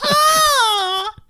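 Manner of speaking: high exasperated shriek; frustrated cry. Real, not acted. Partner keeps getting it wrong, exasperated shriek. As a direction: yelp in exasperation. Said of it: "frustrated at wrong pronunciation".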